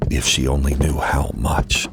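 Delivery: plain reading voice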